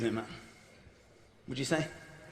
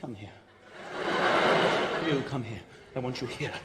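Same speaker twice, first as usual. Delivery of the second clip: much lower, softer camp referee; 11 semitones lower, 5 dB quieter than usual. Retold or imitated. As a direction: beckon him in softly, campy. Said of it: "very camp as well".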